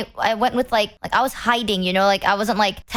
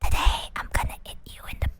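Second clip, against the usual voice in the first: ASMR whisper. Shown as a demonstration. Asked for her ASMR voice, she does a whispered stream intro. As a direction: breathe it close to the mic like an ASMR stream. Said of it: "wanna hear one of my A S M R voices".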